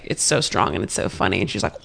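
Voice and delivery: ordinary delivery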